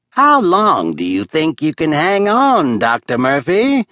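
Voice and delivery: called out with a cackle in it; villain calling out over the gorge, cackling; cackling taunt. Acted. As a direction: taunt him from afar, half laughing. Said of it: "Methuselah called out, cackling".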